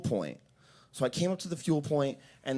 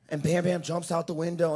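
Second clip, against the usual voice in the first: ordinary talk, nothing marked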